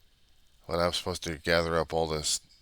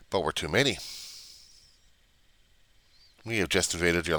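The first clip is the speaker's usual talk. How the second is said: mock cockroach invader; 3 semitones higher, 4 dB louder than usual. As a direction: boast as the invading bug. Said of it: "Why am I talking like this?".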